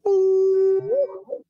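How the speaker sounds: high, loud drawn-out hoot into the woods; high hooting call. Retold or imitated. Date hoots into the woods to call her big boy, high loud hoot.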